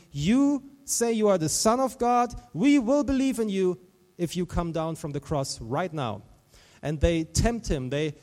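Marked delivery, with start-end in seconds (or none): 0.1-6.2 high, loud challenge, easing off; retold jeer, punched at the start; imitated ridicule. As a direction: imitate a crowd taunting someone. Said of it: "People are just ridiculing him".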